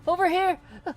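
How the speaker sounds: high, very loud distant call for attention; Rise yelling out. Acted. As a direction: holler it out at full pitch. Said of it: "kinda hear Rise yell out".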